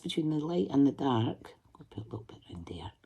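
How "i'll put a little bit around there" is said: whispered under the breath, quick; whispered aside. Real, not acted. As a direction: murmur it to yourself as you work. Muttering while drawing.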